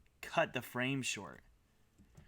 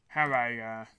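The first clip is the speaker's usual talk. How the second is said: chewing while talking; 5 dB louder than usual. Real, not acted. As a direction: mouth full, words muffled by food. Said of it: "Sorry, I'm chewing my".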